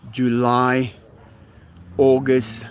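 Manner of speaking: normal talking voice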